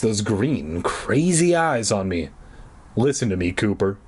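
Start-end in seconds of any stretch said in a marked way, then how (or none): none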